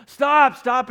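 loud, high-pitched cry of stop; frantic shout. Retold or imitated. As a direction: yell stop at a backing taxi. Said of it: "but I'm just".

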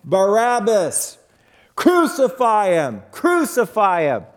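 crowd yelling. Acted. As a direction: chant it like a mob outside the walls. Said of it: "hears as he's in the jail cell".

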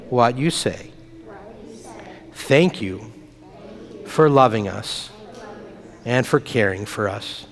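slow, soft call-and-response prayer with long pauses. Acted. Pastor leading children in a repeat-after-me prayer, gentle and slow. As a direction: lead it phrase by phrase for the kids to repeat.

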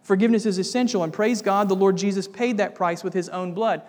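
usual speaking voice

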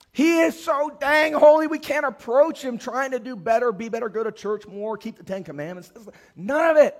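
loud, high, pressing declaration; full-force preaching. Acted. Pastor proclaiming God's love to the church, full-force preaching. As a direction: drive it home to the congregation.

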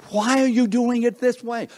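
high, drawn-up baffled question; high-pitched, exaggerated puzzlement; incredulous onlooker. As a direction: question it in high mock bewilderment. Onlooker questions the car pusher.

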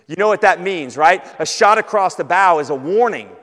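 forceful preaching. Real, not acted. Preacher warning the congregation about seeking their own glory, forceful. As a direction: press the warning home with loud, punched stresses.

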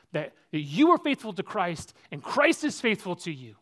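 preacher swearing he'd never trade faithfulness; impassioned preaching. Acted. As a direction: build to a pulpit climax. Preaching climax, loud and high.

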